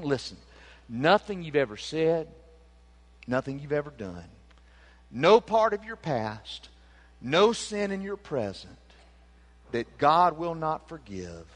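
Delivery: ordinary delivery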